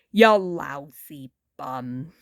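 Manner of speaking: low, to himself, bitter. Acted. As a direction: say it to yourself once alone. Muddy says to himself.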